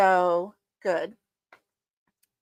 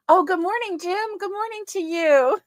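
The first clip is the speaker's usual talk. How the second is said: delighted greeting; 9 semitones higher than usual. Real, not acted. Greets husband watching live.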